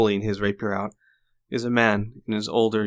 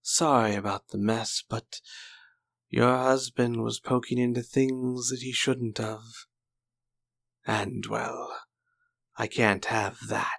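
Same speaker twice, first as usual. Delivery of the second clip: villain's taunt. Acted. Villain speaks to the widow.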